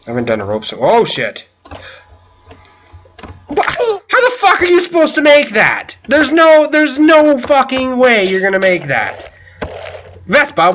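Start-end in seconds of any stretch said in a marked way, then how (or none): 3.5-9.3 high, loud disbelief at the jump; can't believe this is possible; outraged disbelief. Real, not acted.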